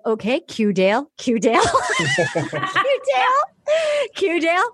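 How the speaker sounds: mock producers cueing Dale, laughing and calling high. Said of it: "producers like".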